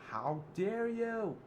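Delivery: high, loud, very slow outrage; mother Alice condemning Zip Oliver; furious accusation. Acted. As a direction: drag out each word in fury. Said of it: "And Alice said".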